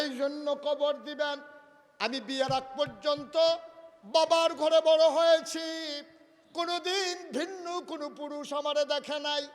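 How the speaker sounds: high, full-force testimony as Fatema; impassioned preaching. Acted. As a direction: proclaim the wife's modesty loudly. Preacher voices Fatema's explanation. (উঁচু গলায় আবেগভরা ওয়াজ)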